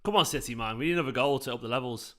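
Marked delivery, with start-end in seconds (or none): none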